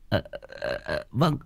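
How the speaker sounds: soft, breathless sheepish yes; hushed embarrassed okay okay; sheepish giggly reply. Acted. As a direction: caught out, mumble a meek agreement. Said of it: "thấy ông như thế thì lại cười hì hì".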